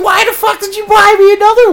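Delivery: imitating an exasperated girlfriend, high and loud; hammed-up girlfriend's indignant shout; mock outraged yell. Megan's retold reaction.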